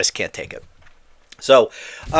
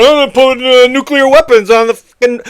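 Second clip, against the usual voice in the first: a mocking Biden; 5 semitones higher, 5 dB louder than usual. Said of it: "And he said".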